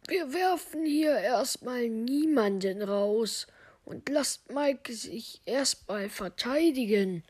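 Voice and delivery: slow, drawn-out acted objection; firm intervening character. Crewmate insists Mike may defend himself, slow firm voice. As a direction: step in slowly and deliberately as a character. Figur stoppt den Rauswurf.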